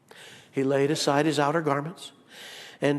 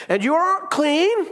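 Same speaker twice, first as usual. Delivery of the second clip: mock disbelief; 11 semitones higher, 6 dB louder, much slower than usual. Mock incredulous question.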